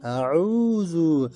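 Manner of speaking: আউযু stretched very slowly, vowels held long; careful slow Arabic pronunciation; drawn-out আউযু. Shown as a demonstration. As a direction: hold every sound of আউযু. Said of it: "এই যে দেখেন".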